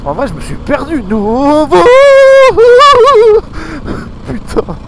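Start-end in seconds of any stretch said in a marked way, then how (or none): 1.7-4.2 loud, high squealing laugh; high loud cackle. Real, not acted. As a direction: burst into a high shriek of laughter. Lost on the trail.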